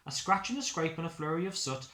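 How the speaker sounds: usual speaking voice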